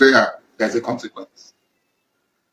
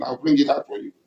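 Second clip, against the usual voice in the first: usual speaking voice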